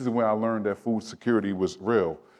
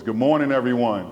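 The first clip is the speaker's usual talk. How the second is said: principal voice; 4 dB louder than usual. Shown as a demonstration. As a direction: boom it out like a school principal. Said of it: "get my principal voice".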